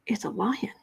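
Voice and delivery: whispered, playful menace; mock spooky whisper. Host teasing viewers about the lion hint, whispered. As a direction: hush it like a campfire secret.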